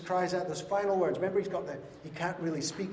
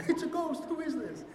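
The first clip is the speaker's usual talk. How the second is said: much higher mock fright; hammed-up fearful disciples; mock startled; 11 semitones higher than usual. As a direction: squeal it like a scared disciple. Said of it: "they're like".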